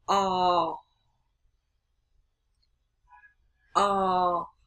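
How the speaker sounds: short open o, mouth wide; short o vowel. Shown as a demonstration. Teacher modelling the short o sound. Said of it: "Nguyên âm ngắn".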